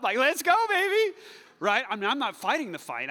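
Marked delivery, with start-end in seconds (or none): 0.2-1.2 high, loud hype-man cheer; excited ringside hype call. Retold or imitated. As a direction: pump up the fighter from the back. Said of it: "I'm the little guy, saddle up".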